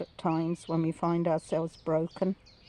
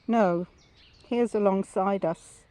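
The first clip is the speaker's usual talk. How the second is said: normal talking voice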